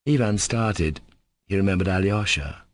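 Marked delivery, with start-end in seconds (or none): none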